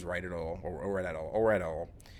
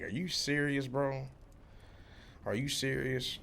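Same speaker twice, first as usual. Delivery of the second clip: higher-pitched retold disbelief; incredulous; 6 semitones higher than usual. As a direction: replay your baffled reaction to an outburst. Retold disbelief at coworker's outburst.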